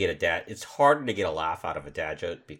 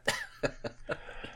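high breathy giggle; corny dad laugh; 8 dB quieter than usual. Real, not acted. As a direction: burst out in a wheezy laugh. Laughing at the joke.

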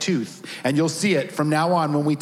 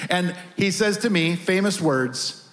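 normal talking voice

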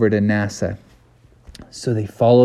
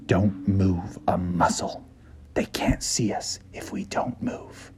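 frozen whisper; 6 semitones lower, 12 dB quieter than usual. Acted. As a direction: whisper so the gator can't hear. Hiding from the gator.

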